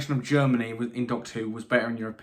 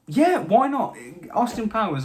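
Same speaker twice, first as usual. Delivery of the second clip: excited; 9 semitones higher, 6 dB louder than usual. Real, not acted. Playful pick of Austin Powers.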